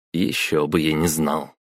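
ordinary delivery

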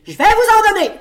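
s'emporter fort et très haut; cri indigné. A narrator's reading. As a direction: menace criée, voix perchée. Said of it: "s'écria-t-il". (menace lancée d'un cri aigu)

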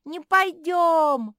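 very loud, high, drawn-out yell; whole-hearted childish protest; children wailing. A narrator's reading. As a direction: holler a stubborn refusal, stretching the vowels. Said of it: "заголосили ежата".

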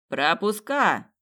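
slow, drawn-out bellow of passes; a guard barking the word through the door, slowly; soldier yelling. Acted. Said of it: "проорал солдат".

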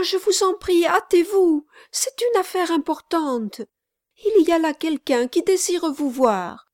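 high, pressing plea to hurry; a breathless servant begging him to drive on; urgent pleading girl. Acted.

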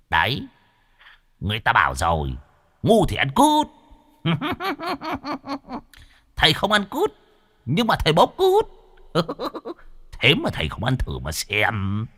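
high, cheeky ghost voice taunting; mocking ghost. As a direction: tease like an impish spirit. (giọng vong cao, trêu chọc)

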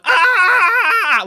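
long high shouted mock scream; mock pained yelp. Jason mocking the big guy's piercings, hammed-up yelp of pain.

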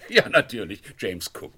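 said through a loud laugh. Acted. Inspector agreeing with the constable, laughing as he speaks. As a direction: laugh the words out.

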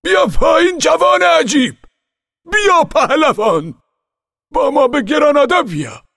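high, loud calling shout, slow on the second cry; shouting up to him. Acted. Nobleman calls the boy down from the tree, loud high shout. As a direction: call up into the tree at full voice.

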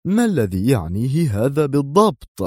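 affronted, swooping pitch; sharp demand. A narrator's reading. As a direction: challenge him sternly. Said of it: "واستدار إليه في حدة".

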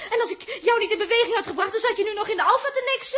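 loud, bitter mocking tirade; sneering furious laugh; mocking shout. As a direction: mimic then attack. Quarrel continues.

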